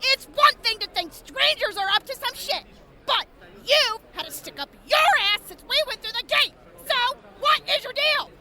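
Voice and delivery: loud, heated tirade, fast at the end; furious rant. Acted. Shoban shouting down the local who hounds them, furious. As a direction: unload all your anger on her. Shoban yelling at the hostile woman.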